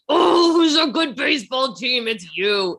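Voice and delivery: put-on high baby talk to a dog; pet talk voice. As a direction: coo like praising a puppy. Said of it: "scratch them behind their ears, like".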